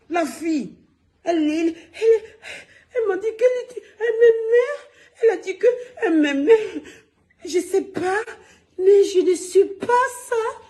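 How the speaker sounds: hammed-up high whiny voice, laughing through it; mocking her voice. Mocking the woman's way of talking to the live viewers, high and laughing. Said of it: "c'est comme ça qu'elle parle".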